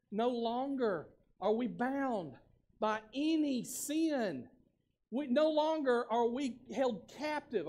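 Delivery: measured, deliberate pulpit delivery; slow emphatic preaching. Acted. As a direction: drag each word out, slow and weighty.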